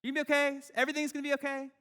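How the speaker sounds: high, loud sing-song comforting; hammed-up cheerful pep talk; mock cheery reassurance. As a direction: play the chirpy friend who brushes pain off. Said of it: "It's like".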